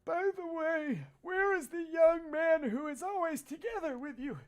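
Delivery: put-on cheerful old neighbour greeting; friendly neighbour uncle. Voices uncle's lines.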